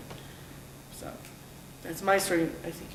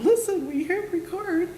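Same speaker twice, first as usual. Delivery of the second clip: high, loud, breathless excitement; excited; 14 semitones higher, 5 dB louder than usual. Retold or imitated. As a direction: blurt it out in a rush of excitement. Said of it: "running back to the bar going".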